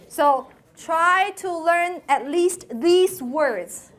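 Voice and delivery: normal talking voice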